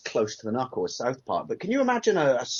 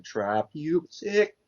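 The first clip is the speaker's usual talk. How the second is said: entranced, drawn-out mock chant; Milhouse; 4 semitones higher, much slower than usual. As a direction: drone it slowly as if in a trance. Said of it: "Milhouse in a trance writing on the wall going".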